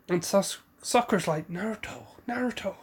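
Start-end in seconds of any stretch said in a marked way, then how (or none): 1.5-2.1 softly murmuring Naruto, to hold him back; hushed plea. Retold or imitated. Sakura quietly urging Naruto to back off, hushed. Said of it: "trying to get him to back off". 2.3-2.8 a higher but still soft Naruto, more urgent; pleading. Retold or imitated. Sakura pleading with Naruto to stop, higher and urgent. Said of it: "trying to get him to back off".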